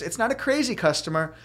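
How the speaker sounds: ordinary talk, nothing marked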